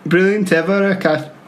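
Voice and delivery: put-on sing-song stab at a Welsh accent. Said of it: "I'm gonna do Welsh".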